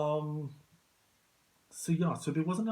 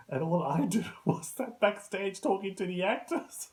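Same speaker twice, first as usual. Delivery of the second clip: higher, cracking up mid-sentence; laughing; 8 semitones higher, 4 dB louder than usual. Real, not acted. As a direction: tell it through rising real laughter. Cracks up at own story.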